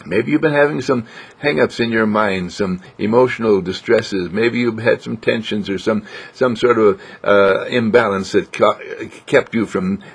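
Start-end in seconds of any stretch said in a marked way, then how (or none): none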